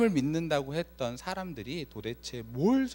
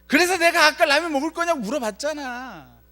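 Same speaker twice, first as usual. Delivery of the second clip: annoyed retort; 12 dB louder than usual. Retold or imitated. Retorting to brother over ramen.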